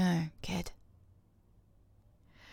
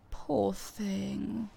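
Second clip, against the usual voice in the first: usual speaking voice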